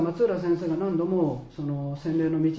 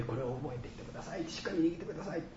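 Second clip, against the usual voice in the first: much softer, as an aside; hushed retold instruction; 9 dB quieter, faster than usual. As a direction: repeat the nurse's request lightly and quietly. Same nurse's words repeated softly aside.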